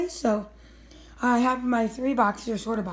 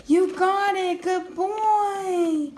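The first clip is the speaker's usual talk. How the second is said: excited praise; 6 semitones higher, much slower than usual. Real, not acted. Dog finds the treat.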